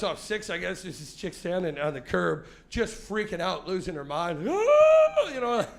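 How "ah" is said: very high loud scream; hysterical shriek. Retold or imitated. Woman on the curb whose car was stolen, shrieking. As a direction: shriek like you're losing your mind.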